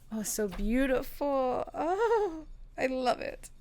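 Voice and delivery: high, drawn-out swoon; moved gushing. Real, not acted. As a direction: melt over it, voice climbing on the second oh. Moved by the card's message.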